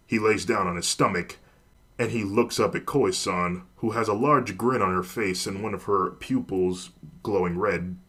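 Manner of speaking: ordinary delivery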